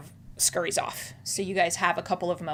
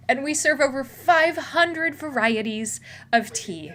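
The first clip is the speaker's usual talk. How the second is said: puffed-up pitch, higher and projected; proud sales spiel; 5 semitones higher, 6 dB louder than usual. Acted. Tea house worker boasting of his five hundred teas, proudly. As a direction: rattle off the boast like a showman. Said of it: "he puffs out his chest and he goes".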